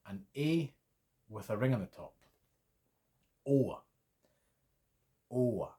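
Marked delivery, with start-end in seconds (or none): 3.5-5.7 long rounded O sound, like English oh; Swedish å. Shown as a demonstration. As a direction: say Å as a long O with a small glide at the end. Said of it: "I learned the sound as O".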